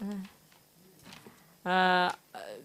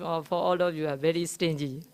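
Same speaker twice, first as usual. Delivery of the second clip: normal talking voice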